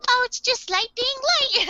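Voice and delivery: high-pitched, breezy put-on voice; imitated little sister. Sister shrugging off Light's outburst, high put-on voice. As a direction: brush it off in a high voice.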